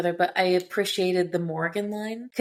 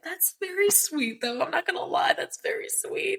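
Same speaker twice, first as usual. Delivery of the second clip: high-pitched, softening coo; gushing; 11 semitones higher than usual. Real, not acted. Gushing over a quoted line.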